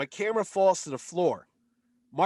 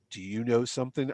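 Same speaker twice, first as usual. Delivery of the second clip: low, measured actor's voice; hint of an impression, pitched down; imitating Hiddleston; 6 semitones lower than usual. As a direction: confide it in a lower voice. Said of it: "And he goes".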